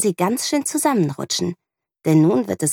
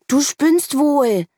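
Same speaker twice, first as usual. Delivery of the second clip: put-on slow, high, indignant child's voice; boy whose sand castle got smashed, slow and indignant; upset boy; 10 semitones higher, 4 dB louder, much slower than usual. A narrator's reading. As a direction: drag it out, cross. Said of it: "regt sich Johannes auf".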